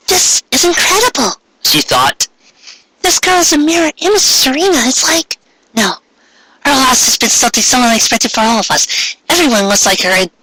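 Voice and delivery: high, stunned inner thoughts; Ami's voice. A narrator's reading. Ami thinking about Serena's double, high and stunned. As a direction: think it aloud, high and amazed.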